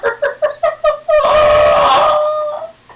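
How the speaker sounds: high screaming laughter; full wild laughing fit; loud shrieking laugh. Real, not acted. Big laugh burst.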